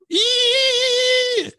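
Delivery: very high piercing mock feedback screech; imitated feedback squeal. Mimicking microphone feedback for the hosts, high squeal. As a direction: squeal like a monitor feeding back. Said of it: "it creates that high pitch".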